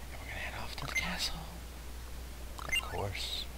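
hushed whisper, fast and soft. Real, not acted. Gamer sneaking to the castle, whispering. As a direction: whisper so the guards won't hear.

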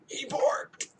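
awed whisper, hushed surprise; thrilled hushed whisper; delighted whisper. Real, not acted. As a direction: breathe out the good news. Dog finally barks.

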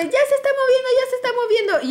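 high-pitched excited mock panic; urgent retelling. Runner reports the phone is moving, excited and high.

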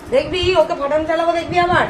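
high, soft playful mock threat; mock threat to toddler. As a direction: tease the toddler with a pretend threat. (বাচ্চাকে মজার হুমকি)

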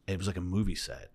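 ordinary delivery

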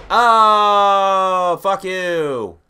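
loud furious yell at the screen; angry shout. Real, not acted. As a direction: shout it at the opponent. Angry at game opponent.

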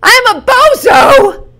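high, slow, loud self-mockery; a loud, drawn-out cry of I am a bozo; self-mocking shout. Real, not acted. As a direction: bellow it at yourself, slowly. Mocking himself loudly.

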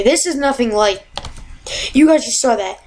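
normal talking voice

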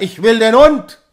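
very loud high demand; furious shout. A narrator's reading. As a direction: yell down the slope. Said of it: "schrie er". (wütender Schrei)

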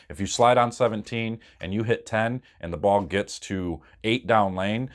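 usual speaking voice

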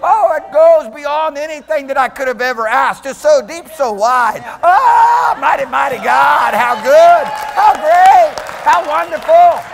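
high, loud ecstatic praise; fervent shouted worship; preaching at full force. Real, not acted.

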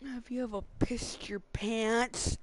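slow hushed whisper. Acted. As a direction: whisper it slowly. Whispered repeat of earlier question.

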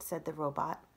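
ordinary delivery, nothing marked

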